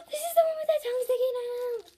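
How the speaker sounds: high, quick giddy telling; excited. Real, not acted. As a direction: gush about the find. Sees favourite design.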